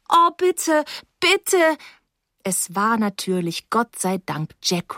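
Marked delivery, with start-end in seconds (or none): 0.1-1.8 high, urgent begging; frantic girl begging for help; desperate pleading. Acted.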